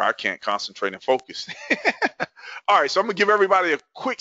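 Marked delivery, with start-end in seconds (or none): 1.5-2.6 short high-pitched laugh; amused laugh. Real, not acted. Host admits he can't concentrate, amused high laugh. Laughs at being unable to focus.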